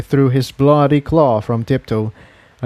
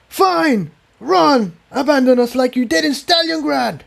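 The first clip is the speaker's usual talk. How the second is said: angry shout; 12 semitones higher than usual. Acted. Jigsaw shouts after fleeing Incendia, high and bitter. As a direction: hurl it at her back as she flees. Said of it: "he shouted after her".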